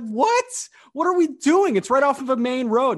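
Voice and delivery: high, loud incredulous yelps; mock-incredulous shout; outraged disbelief. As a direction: act stunned at the clerk. Retold outraged reply.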